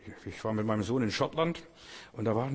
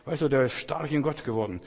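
ordinary delivery

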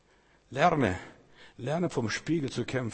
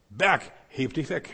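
commanding word; 3 semitones higher than usual. Shown as a demonstration. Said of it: "Du brauchst vom Herrn das richtige Wort".

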